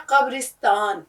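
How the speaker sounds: قبرستان slowly, qab-ris-taan; correct pronunciation. Shown as a demonstration. Teacher giving learners the right form, قبرستان slowly as qabristaan. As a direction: draw out قبرستان with a short i after r. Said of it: "یہ ہے قبرستان".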